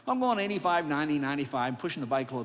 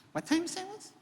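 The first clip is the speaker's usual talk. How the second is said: higher, softer puzzled question; confused intern; 13 semitones higher, 5 dB quieter, faster than usual. Retold or imitated. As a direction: ask as if you misheard.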